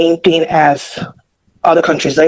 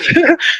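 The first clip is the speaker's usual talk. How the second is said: short laugh; 13 semitones higher than usual. Real, not acted. Laughs at own breathlessness.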